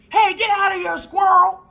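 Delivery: high, loud imitated husband's yell; shouting. Husband shouting at a squirrel in the attic, high yell. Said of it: "shouting through the attic".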